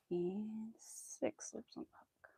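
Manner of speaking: slow hushed tally; slow whispered count. Real, not acted. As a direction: whisper it slowly, almost to yourself. Counting loops while crocheting.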